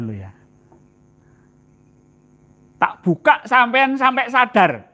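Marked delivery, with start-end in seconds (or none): 2.8-4.9 high, loud and slow, drawn out; booming declaration. Real, not acted. Preacher booming to the congregation to wake them.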